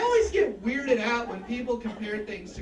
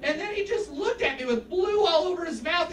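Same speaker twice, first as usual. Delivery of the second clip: ordinary talk, nothing marked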